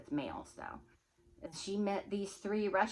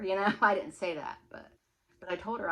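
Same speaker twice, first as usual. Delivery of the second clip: ordinary delivery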